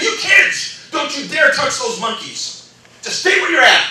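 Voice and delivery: high, loud mock scolding; retold yelling. Trainer turning on the schoolkids, retold yelling. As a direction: lay down the law, imitating an angry trainer. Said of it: "he's yelling at us".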